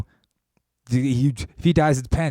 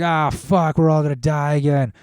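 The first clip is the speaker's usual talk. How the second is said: mock doom; 5 semitones higher, 5 dB louder than usual. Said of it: "everybody's like".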